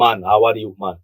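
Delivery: loud imitated Nigerian greeting; Nigerian accent. Showing an accent he likes, Nigerian accent. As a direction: put on a hearty Nigerian accent. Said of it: "Nigerian accent".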